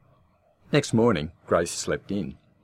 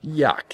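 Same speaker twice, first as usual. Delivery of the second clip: disgusted yuck; 10 semitones higher, 5 dB louder than usual. Acted. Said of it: "he growled".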